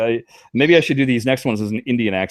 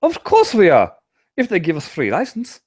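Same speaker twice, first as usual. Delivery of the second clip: higher, louder, hammed-up German accent; mock German accent; 10 semitones higher, 4 dB louder than usual. Host answering the Jersey Jack question in a mock German accent. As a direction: play a cartoon German spokesman. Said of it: "I can't even call this a German accent".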